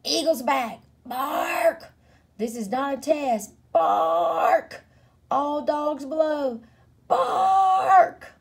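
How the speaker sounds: high siren-like announcement with rough barks; watchdog alarm. A narrator's reading. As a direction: call the warning between long, rough barks.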